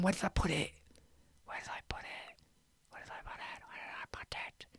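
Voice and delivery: very faint whisper to oneself; barely audible self-talk. Real, not acted. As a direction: whisper it under your breath while searching. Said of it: "Sorry, I'm whispering now".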